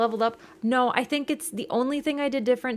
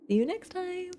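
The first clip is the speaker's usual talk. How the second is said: high, small farewell voice; cutesy sign-off; 10 semitones higher, 6 dB quieter than usual. Real, not acted. Streamer saying good night to viewers, cutesy high sign-off. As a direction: sign off lightly in a high little voice.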